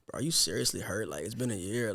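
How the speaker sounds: low, soft grumble under the breath; muttering. Retold or imitated. Student reacting to teacher's old grudge, muttering. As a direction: imitate muttering to yourself in disbelief. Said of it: "mummering words, muttering words".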